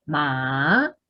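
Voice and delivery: "ma" drawn down and back up; full third tone. Retold or imitated. Teacher imitating what students memorise, falling-rising "ma". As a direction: swoop through a whole dip and rise on "ma". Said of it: "decir el tercer tono completo".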